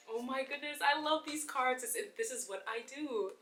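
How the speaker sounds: high-pitched delighted gush; excited. Real, not acted. Yoga host thrilled by the cards she drew, excited. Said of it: "I'm getting all excited".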